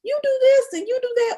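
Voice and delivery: high, mock-preachy voice of people giving advice; mocking quote. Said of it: "They say".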